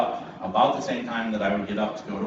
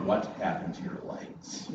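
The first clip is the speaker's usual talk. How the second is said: a softer, breathy wife's question; hushed wife; 4 semitones lower, 8 dB quieter than usual. Retold or imitated. Wife on the couch spotting his scratched legs, hushed.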